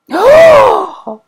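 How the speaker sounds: a sudden high gasp of shock; sharp gasp. Real, not acted. Tarot reader gasps at her card to viewers. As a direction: catch a loud, startled breath.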